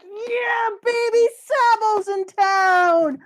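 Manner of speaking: very high, loud, slow whooping cheer; ecstatic cheer. Real, not acted. Fan cheering a beloved character's return, loud high whoop. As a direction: whoop it like your team scored. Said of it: "and we're like".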